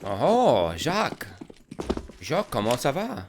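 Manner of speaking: hammed-up French greeting with swooping pitch; French accent. Caster greets an imaginary Jacques, hammed-up French accent. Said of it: "like, a French accent".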